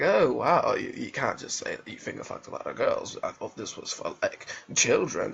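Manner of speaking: very low, hushed scolding; mock objector. Listener objecting that this was for children, low hushed mock voice. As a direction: drop deep and soft to voice disapproval.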